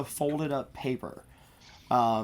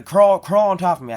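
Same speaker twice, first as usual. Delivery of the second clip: calling out; 3 semitones higher, 10 dB louder than usual. Acted. Donakai calling to the bat.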